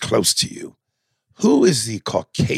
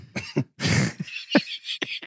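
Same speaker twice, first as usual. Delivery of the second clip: high wheezing laugh; 7 dB quieter than usual. Real, not acted.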